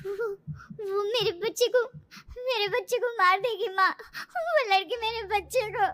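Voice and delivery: high, crying, broken pleas; tearful panic. Acted. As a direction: sob it out to mother, still terrified. Dazed woman tells mother her nightmare. (रोती, सहमी हुई आवाज़)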